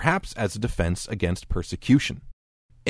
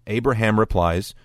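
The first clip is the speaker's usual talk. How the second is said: normal talking voice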